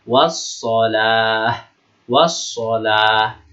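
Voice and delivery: slow "Wassalah", doubled s with no l before it; silent alif lam. Shown as a demonstration. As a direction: recite "Wassalah", holding ss and the long vowel. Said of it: "Itu namanya alif lam syamsiah".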